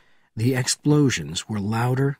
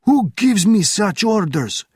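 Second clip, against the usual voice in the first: high, loud, indignant demand; stretched, challenging yell; shouting officer; 10 semitones higher, 6 dB louder than usual. A narrator's reading. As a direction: shout it slowly in outrage. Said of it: "shouted the officer".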